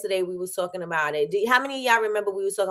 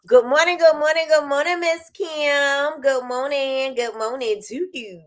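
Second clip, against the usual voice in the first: high, lilting good mornings; sing-song greeting; 7 semitones higher than usual. Real, not acted. Host greets Miss Kim in a high sing-song. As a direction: sing it out to a friend.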